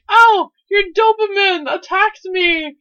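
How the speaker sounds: high, loud mock shriek; hysterical old lady. Old lady accusing a dog owner, hysterical shriek. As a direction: shriek it like an outraged dog-walker. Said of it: "she's like".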